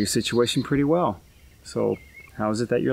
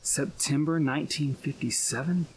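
slow, hushed echo, rising into a question; quiet, drawn-out incredulous question; stunned disbelief; 9 dB quieter than usual. Acted. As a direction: repeat it softly, as if it cannot be true. Stunned at the year given.

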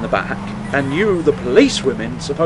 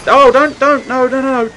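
alarmed protest; 9 semitones higher, 5 dB louder than usual. Real, not acted. Pleading as someone runs in.

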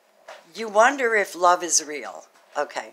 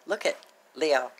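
ordinary delivery

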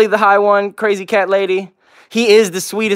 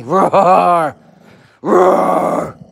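drawn-out pretend beast roar; playful roar. Owner playing scary with the dog, playful roaring. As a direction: roar in mock ferocity.

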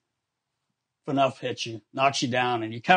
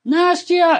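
much higher and louder yell; yelling name; 8 dB louder than usual. Retold or imitated. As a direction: bellow the name down the hall, insisting. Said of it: "So he would yell".

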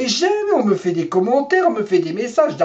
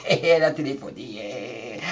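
ordinary talk, nothing marked

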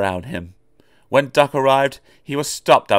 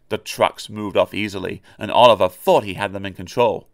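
ordinary delivery

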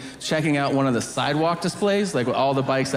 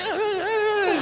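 high mock whimpering; string of high, put-on scared cries; frightened whimper; slower than usual. Kid reacts to prisoner's threat, high mock whimper.

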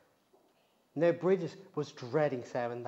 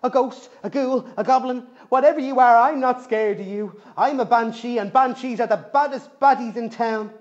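much louder, high forced bravado; fake brave; 8 semitones higher, 12 dB louder than usual. Scared Bridget bluffs at the rustling thing. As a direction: shout to hide the fear. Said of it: "trying her best to sound brave".

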